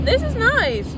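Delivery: surprised. Real, not acted. Taste test, pleasantly surprised.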